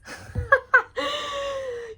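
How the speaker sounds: high, delighted laugh; thrilled giggle; excited laugh. Real, not acted. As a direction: let a happy laugh burst out. Laughing after pulling card.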